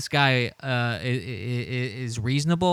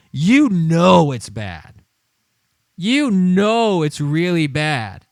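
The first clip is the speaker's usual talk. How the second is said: gloating; 7 dB louder, slower than usual. Real, not acted. Slow loud gloating emphasis.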